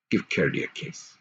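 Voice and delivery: low, hushed, almost whispered; quiet king. A narrator's reading. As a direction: gently urge her to kiss him. King voiced.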